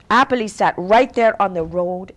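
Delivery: usual speaking voice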